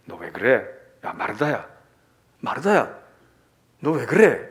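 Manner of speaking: loud scolding call of the name; rebuking call. Acted. Jesus rebuking Martha with a loud call. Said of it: "책망하는".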